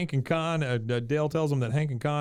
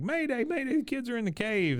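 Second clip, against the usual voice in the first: high, panicked alarm call; mock panic, pitched way up; frantic mayday; 11 semitones higher than usual. Dale's frantic alarm call quoted.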